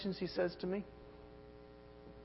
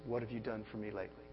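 much softer, quick murmured line; hushed, fast, the voice inside each of us; little inner voice; 6 semitones lower, 10 dB quieter, faster than usual. Retold or imitated. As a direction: slip it in like a nagging inner voice. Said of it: "a little voice that whispers".

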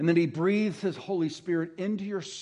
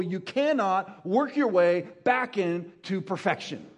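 higher, fast-building pulpit run that slows at the end; impassioned preaching; 4 semitones higher than usual. Acted. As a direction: revival preacher driving it home. Preaching that no effort saves.

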